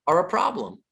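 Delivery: ordinary talk, nothing marked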